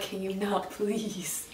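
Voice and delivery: very soft, imploring request; retold plea, voice dropped low in volume. Retells pleading with protective pet.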